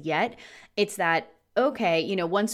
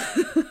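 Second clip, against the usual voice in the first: high laugh; 11 semitones higher than usual. Real, not acted. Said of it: "just cracking up".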